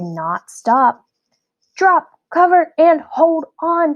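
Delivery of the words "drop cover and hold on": loud high urgent order; urgent command. A narrator's reading. Teacher orders the class to take cover. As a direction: call out the drill firmly.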